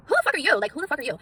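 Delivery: high, loud indignant outburst that eases on the repeat; explosive start, settling as it repeats; worked up. Acted. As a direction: snap back at critics, theatrically outraged. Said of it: "I got worked up again".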